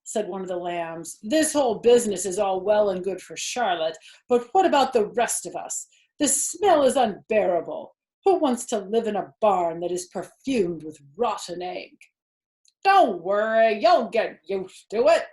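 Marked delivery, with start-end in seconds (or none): 1.2-12.0 complaining lamb. Acted. Lamb complains about smell. 12.8-15.3 rat voice. Acted. Templeton the rat reassures.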